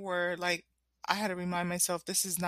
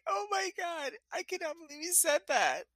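high, laughing disbelief, voice pitched way up; shocked disbelief; 10 semitones higher, 3 dB quieter than usual. Retold or imitated. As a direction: squeal it out in stunned, giggly shock. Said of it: "I'm like".

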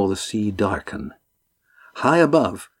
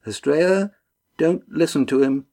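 higher-pitched, lightly voiced warning; 6 semitones higher than usual. A narrator's reading. Lindy cautioning Estrella from behind, higher warning voice. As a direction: warn him, pitch raised. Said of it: "Lindy's warning came from behind".

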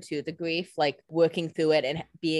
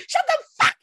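shrieking, much higher and louder, full fury; furious scream; 6 dB louder, much faster than usual. Retold or imitated. Co-host imagines screaming at Stefan, shrieking mock rage. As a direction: scream it as if you'd snap. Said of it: "That's when I would have been like".

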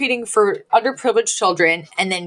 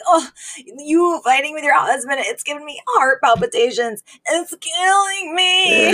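hammed-up wail climbing high; hysterical; 7 semitones higher than usual. Mother guilt-tripping her daughter over a fight, hysterical. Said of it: "becomes absolutely hysterical".

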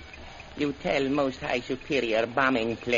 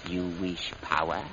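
ordinary delivery

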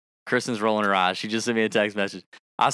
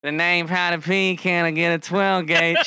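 higher nasally rap voice; nasal rapper voice; 5 semitones higher than usual. Retold or imitated. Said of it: "that real nasally voice".

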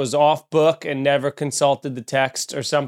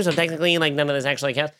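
high, prissy know-it-all voice, much higher; tattling in a pitched-up nasal-free whine; mock snitch; 3 semitones higher, faster than usual. Snitch tattling on the priest in a high know-it-all voice.

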